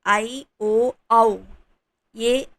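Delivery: ordinary talk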